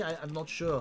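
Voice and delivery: put-on New Zealand accent; Kiwi accent. Joking that Peter Jackson rubbed off, Kiwi accent. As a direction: keep up a casual mock Kiwi. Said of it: "a little bit more Kiwi".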